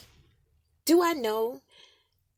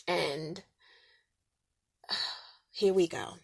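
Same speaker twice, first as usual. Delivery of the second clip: usual speaking voice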